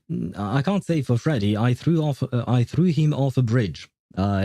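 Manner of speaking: ordinary delivery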